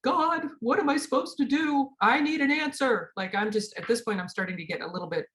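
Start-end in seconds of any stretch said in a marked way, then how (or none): 0.0-3.1 high, pleading cry to God; pleading through tears. Retold or imitated. Sobbing by the fountain, praying to God, high and pleading. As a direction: beg for an answer, near tears. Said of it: "just sobbing".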